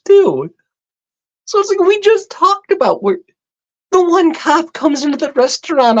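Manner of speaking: venting in real disbelief at the cops; worked-up rant. Real, not acted. Angry rant about the cops.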